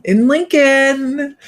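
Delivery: drawn out high and slow; playful drawn-out place name; sing-song. Pretend. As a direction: stretch in Lincoln out, high and sung. Playful repeat of town name.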